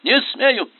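high, loud frightened begging; lieutenant refusing to shoot, desperate; desperate pleading. A narrator's reading. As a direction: plead with the general in panic. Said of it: "отчаянно закричал поручик".

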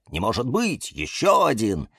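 usual speaking voice

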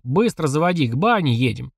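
ordinary delivery, nothing marked

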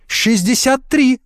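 high, drawn-out startled answer; startled reply. A narrator's reading. Startled applicant answering the boss, high and slow. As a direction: jump to answer, pitched high. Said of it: "подскочила девица".

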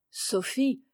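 ordinary delivery

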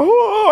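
loud, high, exaggerated wailing "oh"; put-on burst of overwrought emotion; mock emotional wail. Shown as a demonstration. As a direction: wail it out, hammed-up. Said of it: "I ain't talking emotional".

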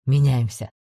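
low, curt commander order; firm low command. A narrator's reading.

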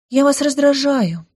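high little nymph voice; pixie. Acted. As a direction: say it brightly and plainly.

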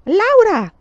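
high, loud shout of a name; piercing angry call; teacher's shout. A narrator's reading. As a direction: shout to stop the pupil. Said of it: "gridò la signorina Wilder".

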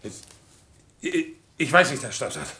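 high, faltering stammer; shaking soldier struggling to get words out; trembling fit. Acted. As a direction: stammer helplessly to the army doctor. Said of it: "Der Mann bekommt einen Zitteranfall".

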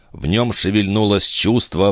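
ordinary delivery

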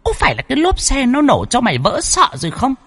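high, quick scolding; lightly voiced spirit demanding an answer. Spirit scolds Tuấn, high and fast.

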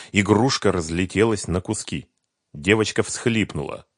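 plain reading voice

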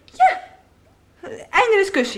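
defiant retort. Acted. Pupil cuts off teacher.

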